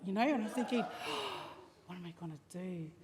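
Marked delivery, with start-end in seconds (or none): 0.9-1.6 acted gasp of dread; frightened intake of breath, re-enacted; dramatic gasp. Retold dread while hiding. 1.9-2.9 low, very soft worried thought; quiet re-enacted helplessness; hushed dread. Alone at dawn, afraid.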